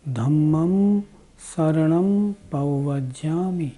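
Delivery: slow, clear recitation with every syllable spaced out; slow careful recitation. Shown as a demonstration. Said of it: "इसका उच्चारण जब भी करें, शुद्ध ही करें". (धीमा और साफ़ पाठ)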